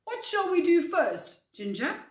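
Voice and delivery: high, loud, eager asking; excited question. Acted. Floppy asking Ginger what to do first, excited. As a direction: ask it bursting with eagerness. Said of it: "asked Floppy excitedly".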